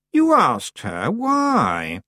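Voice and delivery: put-on very slow, suspicious girl. A narrator's reading. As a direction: question her with suspicion. Anagrama suspicious.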